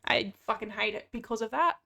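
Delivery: ordinary talk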